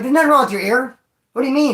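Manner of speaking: incredulous. Retold or imitated. Friends dismissing the injury.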